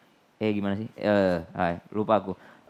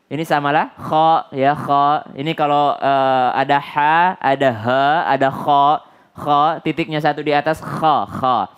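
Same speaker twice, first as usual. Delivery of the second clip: normal talking voice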